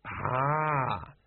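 long, knowing "ah" of feigned understanding; drawn-out sage acknowledgement; wise. A narrator's reading. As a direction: nod along sagely as you say it. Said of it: "the sergeant nodded wisely".

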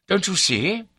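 high, loud, exasperated question; impatient. A narrator's reading. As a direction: demand that he catch on.

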